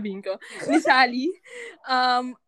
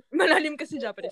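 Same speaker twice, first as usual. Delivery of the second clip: ordinary talk, nothing marked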